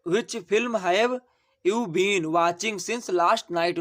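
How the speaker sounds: very slow, word by word; dictating slowly. Shown as a demonstration. Teacher dictates the answer to students, very slowly. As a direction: spell it out for the class. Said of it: "teacher dictating the English answer".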